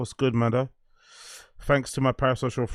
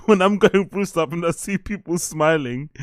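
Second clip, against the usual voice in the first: higher, giggly confession; laughing; 5 semitones higher, 3 dB louder than usual. Real, not acted. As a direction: struggle through it with laughter in the voice. Laughing at own toxic confession.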